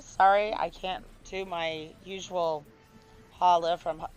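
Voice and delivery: ordinary talk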